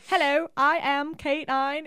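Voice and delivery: high, stiff robot dog voice. Retold or imitated. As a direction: imitate a little robot speaking. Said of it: "in its robotic little voice".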